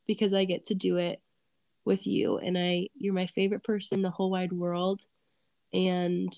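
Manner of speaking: usual speaking voice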